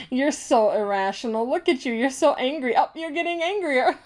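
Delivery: mock someone's anger with giggly glee; gloating sociopath. Imitating a sociopath who made you mad, gloating and laughing. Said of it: "they just tell themselves".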